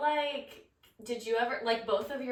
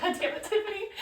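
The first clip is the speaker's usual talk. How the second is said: mock exasperation; 11 semitones higher than usual. Mock annoyance at crying friend.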